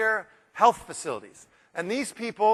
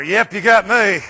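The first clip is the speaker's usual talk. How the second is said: slow, loud smart-aleck voice; wise guy quip; 11 dB louder, slower than usual. Retold or imitated. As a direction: play the joker owning up with a smirk. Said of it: "some wise guy".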